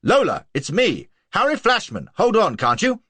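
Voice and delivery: high, loud shouting after a coach; calling out. A narrator's reading.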